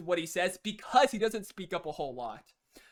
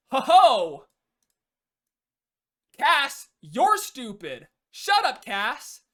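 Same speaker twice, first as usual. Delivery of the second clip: loud, much higher mocking snap; defensive outburst; 5 semitones higher, 8 dB louder, much slower than usual. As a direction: bite back hard at the teammate. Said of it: "gets defensive and says".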